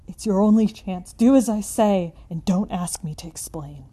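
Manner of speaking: loud, desperate, urgent warning; wild consternation. Acted. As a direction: plead frantically for him to flee. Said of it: "in a pitch of wilder consternation".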